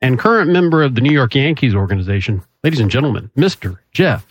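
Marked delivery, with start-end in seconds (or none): none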